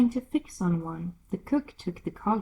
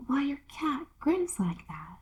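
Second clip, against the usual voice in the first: put-on hushed, timid little girl; whispery Alice; 7 semitones higher, 4 dB quieter than usual. Alice whispering timidly to the Duchess. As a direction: ask it under your breath, shyly.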